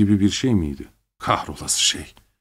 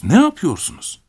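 ne yapıyorsunuz jumping much higher, urgent; alarmed; 10 semitones higher than usual. A narrator's reading. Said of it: "mırıldandım". (ne yapıyorsunuz çok daha tiz, telaşla)

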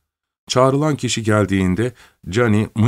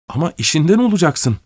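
mother crying out, pitched up; urgent raised call, not full volume; 7 semitones higher than usual. Acted. Mother crying out to her son not to lose his job. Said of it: "diye haykırdı kadın".

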